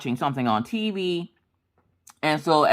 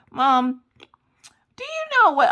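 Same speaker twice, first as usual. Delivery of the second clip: put-on high, slowly drawn-out kid voice; pestering child; 10 semitones higher, 3 dB louder, much slower than usual. Daughter interrupts mom yet again, high drawn-out pestering. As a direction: imitate a child dragging out a call to mom. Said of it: "she would come down, like, every ten minutes".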